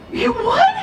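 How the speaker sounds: delighted high exclaim; excited. Acted. Teacher thrilled at child's answer, excited. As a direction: cheer them on with surprise.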